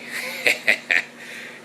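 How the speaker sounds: breathy chuckle; short voiceless laugh. Real, not acted. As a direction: chuckle to yourself. Laughs after remark.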